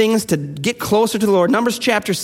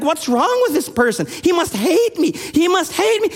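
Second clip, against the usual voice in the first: baffled worry; 8 semitones higher than usual. Retold or imitated. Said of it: "I'm saying, like".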